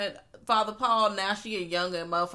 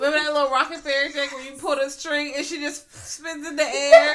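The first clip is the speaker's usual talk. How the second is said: laughing tease; 8 semitones higher, 6 dB louder than usual. Real, not acted. Teasing each other, laughing.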